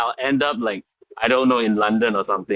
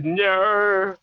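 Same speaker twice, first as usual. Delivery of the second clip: famous Australian "no"; 7 semitones higher than usual. Acted. Guest quotes an Australian comedy catchphrase, Australian accent. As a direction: stretch the vowel in mock Australian. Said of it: "it's the famous".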